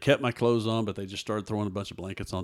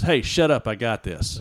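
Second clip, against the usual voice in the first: a louder, offhand hush-up, like a buddy taking over; God's voice, blunt and casual; 3 semitones higher, 8 dB louder than usual. Retold or imitated. God telling the drowning man to relax, in a loud offhand voice. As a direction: tell him to pipe down, casually confident. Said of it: "what I heard was".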